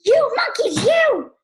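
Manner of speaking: very high, loud storybook shout; furious shout. A narrator's reading. Very angry peddler shouts at the monkeys, furious shout. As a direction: yell it in high-pitched outrage. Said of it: "He stomped both feet and shouted".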